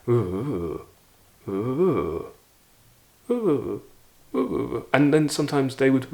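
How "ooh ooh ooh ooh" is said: mimicking muffled voices through a door; light murmured ooh sounds, as if heard through a wall. Student imitates the interviewers' muffled tone through the door, lightly murmured. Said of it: "you can hear the tone".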